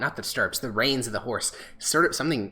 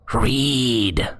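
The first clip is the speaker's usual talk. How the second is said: loud, strange one-word command; a disembodied voice insisting; eerie unseen voice commanding the druid to read; mysterious voice; 7 dB louder than usual. Acted. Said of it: "you hear a response".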